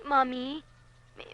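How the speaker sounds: normal talking voice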